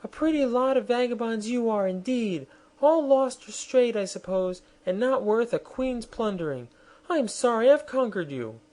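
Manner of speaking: high, haughty queen's sneer; a proud queen mocking her captives; scornful queen. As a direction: look down your nose at the vagabonds. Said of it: "said Anne scornfully".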